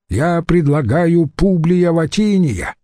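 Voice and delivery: high, slow ranting list of names; raging. Acted. Antony rages at the senate, shouting names high and slow. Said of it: "бушевал Марк Антоний".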